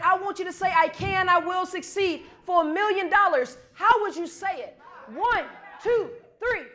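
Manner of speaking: high, forceful hype-up; rally the crowd with rising intensity; fired up. Real, not acted. Speaker fires up the audience for one last try, fired up.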